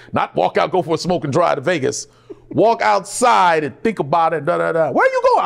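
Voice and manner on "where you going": high, loud mock wife asking; the wife calling after him; demanding wife. Wife asks as he leaves.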